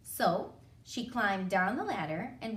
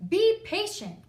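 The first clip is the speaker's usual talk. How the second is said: loud, high dinosaur rebuke; raised storybook voice; scolding dinosaur; 9 semitones higher, 7 dB louder than usual. A narrator's reading. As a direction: call it out as an impatient dinosaur.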